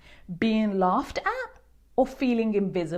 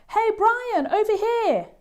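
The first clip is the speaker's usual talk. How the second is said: high, louder call to come over; shout; 14 semitones higher, 4 dB louder than usual. A narrator's reading. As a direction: call him across the room. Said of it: "he hears someone shout".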